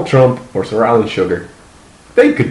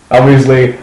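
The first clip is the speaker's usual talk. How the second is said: normal talking voice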